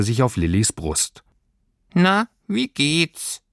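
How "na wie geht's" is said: high, slow, sad creature voice; a tiny sprite asking sadly; tiny homesickness creature asks Lilly sadly; sad little Heimweh. A narrator's reading. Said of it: "fragte es traurig".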